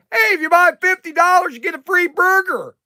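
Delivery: high, loud hawker's call to customers; mock sales shout. Owner calling a deal to customers walking in, loud and high. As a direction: belt the deal out like a burger-counter barker. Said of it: "I'm gonna go".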